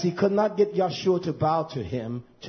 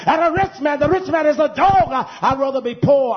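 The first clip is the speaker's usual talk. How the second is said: raised, insistent sermon voice, building; fiery preaching; 7 semitones higher, 5 dB louder than usual. Real, not acted. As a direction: drive each phrase home like a revival preacher. Preaching at full force.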